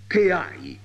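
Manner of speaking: con ira. A narrator's reading. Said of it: "domandò anche a lei con ira".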